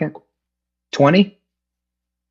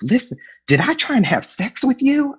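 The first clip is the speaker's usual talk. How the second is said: very high, imitated Southern accent; 14 semitones higher than usual. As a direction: ask it as a squeaky little Southerner. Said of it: "this little Southern accent".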